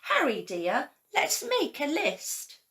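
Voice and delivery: a bright little lift for Betty; Betty scarecrow voice. A narrator's reading. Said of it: "Then Betty said".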